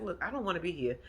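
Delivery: soft mock reluctance; imitating Aunt Bertha. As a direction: lightly mimic an unwilling aunt, volume down. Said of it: "She's like".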